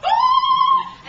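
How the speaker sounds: high, loud shriek; acted terrified scream. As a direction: scream as if falling from a bridge. Screaming during bungee fall.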